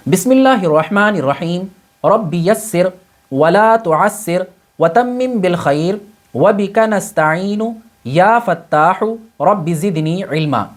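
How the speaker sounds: slow devotional recitation; recited prayer. Acted. Opening prayer before lesson. (دعا کے انداز میں آہستہ تلاوت)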